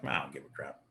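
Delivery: imitating a relaxed trader who doesn't care; mock indifference. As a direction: shrug it off under your breath. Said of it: "you'd be like".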